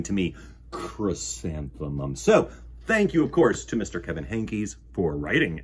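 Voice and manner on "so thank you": usual speaking voice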